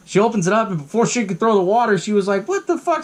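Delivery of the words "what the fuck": very high startled exclamation; shocked old lady. Retold or imitated. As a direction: gasp out in disbelief. Mrs Deagle shocked seeing gremlins.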